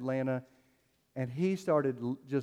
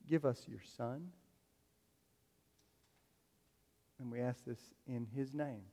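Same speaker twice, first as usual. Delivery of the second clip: soft, unhurried prayer voice; 3 semitones lower, 6 dB quieter than usual. Real, not acted. Pastor praying over the church before communion, soft. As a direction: pray quietly and reverently.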